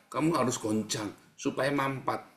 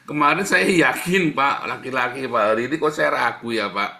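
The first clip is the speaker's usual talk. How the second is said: tag; 7 dB louder than usual. Acted. Memerankan orang yang ragu.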